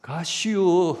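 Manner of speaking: playful Chungcheong impression, punched out; curt Chungcheong word. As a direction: toss off a blunt Chungcheong punchline. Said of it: "충청도 사람은요".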